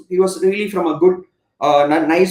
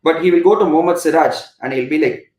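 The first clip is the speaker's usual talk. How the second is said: normal talking voice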